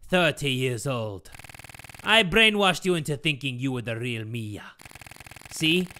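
put-on low accented villain father; hammed-up puppet-master monologue; villainous Geppetto, gloating. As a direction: gloat over your perfect plan.

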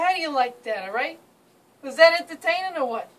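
brash New Jersey accent; thick New York and Jersey street accent. Shown as a demonstration. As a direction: talk like a guy from New Jersey. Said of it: "I'm going to demonstrate his accent".

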